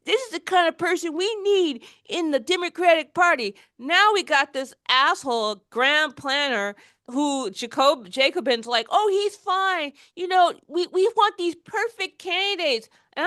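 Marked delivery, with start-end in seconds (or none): 0.0-3.6 higher-pitched parody of a naive believer; lightly mocking, higher voice; mocking gushing supporter. Mimicking everybody's naive praise of candidate. 8.9-12.9 higher, dismissive parody of an excuser; lightly mocking, higher voice; mocking apologist. Mimicking Jacobin excusing the candidate.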